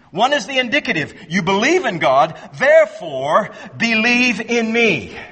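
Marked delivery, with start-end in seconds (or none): none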